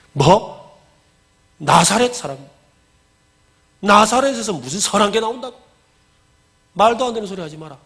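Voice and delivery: acted scoffing questions; mock disbelief. Nathanael answers Philip's news, scoffing in disbelief. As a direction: question it as if it's nonsense.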